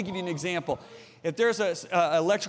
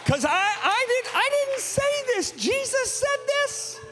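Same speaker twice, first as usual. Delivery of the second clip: very high-pitched, slow disclaimer; high, stretched preacher's insistence; emphatic protest; slower than usual. Real, not acted. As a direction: protest it at the top of your register. Preacher defending himself to congregation.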